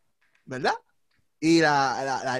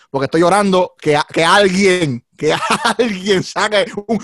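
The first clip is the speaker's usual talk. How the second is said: laughing; 8 dB louder than usual. Real, not acted. Joking while laughing.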